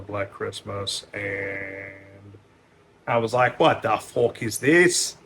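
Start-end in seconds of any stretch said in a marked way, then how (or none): 3.6-5.2 slow, loud Scottish curse; mock-Scottish outrage at a film; Scottish accent. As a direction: spit it out like an angry Scotsman. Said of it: "I do it in a Scottish accent".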